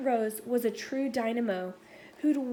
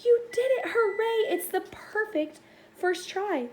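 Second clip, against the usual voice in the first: joyful cheer; 7 semitones higher than usual. A narrator's reading. Great-great-aunt cheering Rosie's first try, high and joyful. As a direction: cheer her on like she just flew. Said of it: "started to cry".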